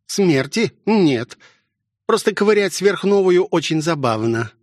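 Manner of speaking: high, mocking nurse with a wicked smirk. A narrator's reading. Nurse gloats over the injured pilot, smirking wickedly. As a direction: sneer it out with gleeful malice. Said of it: "злобно усмехнувшись".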